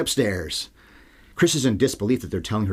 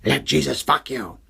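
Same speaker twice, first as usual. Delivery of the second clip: slow, higher demonic snarl, lightly done; 6 semitones higher, slower than usual. Retold or imitated. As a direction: spit out the possessed girl's line. Quoting the possessed girl.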